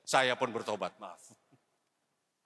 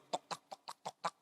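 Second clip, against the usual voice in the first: soft unvoiced clicking of heels on the floor; high heel clicks; 8 dB quieter than usual. Preacher imitating his wife's church heels with soft clicks. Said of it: "Yang kalau jalan bunyi tak".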